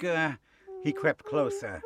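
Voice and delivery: ordinary delivery, nothing marked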